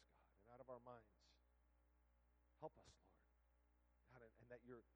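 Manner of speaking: soft, earnest prayer over the church; pastoral prayer. Acted. Leads closing prayer.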